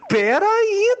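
squealing surprise; high startled squeal; sudden high gasp. Acted. Sudden interest.

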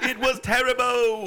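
high, laughing British wail; exaggerated high British outburst; British accent. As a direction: cry out in hammed-up British, amused.

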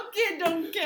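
high, loud mock-Scottish ogre; Shrek impression. Player joins the Shrek riff, mock Scottish accent. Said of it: "You turned into Shrek".

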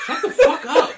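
high, laughing outburst; mock exasperated yell. Kevin to sarcastic coworker, retold exasperated yell.

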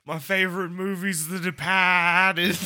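put-on joking Boston accent. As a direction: claim it like a proud Bostonian. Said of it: "the Boston accent challenge".

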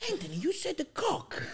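imitated accusing dad. Dad accusing son over a word, mock high-pitched protest. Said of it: "I said, "What are you talking about?".

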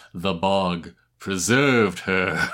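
slow, low, relished character line; hammed-up slow imitation of a favorite actor; imitated dramatic delivery. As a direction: draw out each word with theatrical gravity. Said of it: "I love his delivery on this line".

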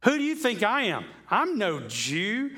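indignant, rising high and sharp; scornful Pilate. Retold or imitated. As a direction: scoff it like an insulted governor. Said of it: "you can hear in the tone of his voice".

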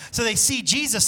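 ordinary delivery

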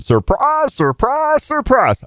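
high, drawn-out hillbilly surprise; Gomer Pyle voice. Retold or imitated. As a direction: let it leap up like Gomer Pyle. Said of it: "Sounds like a little Gomer Pyle".

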